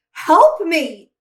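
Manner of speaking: loud, high plea for help; desperate cry. Acted. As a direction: call out in a panic. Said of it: "Maria cried".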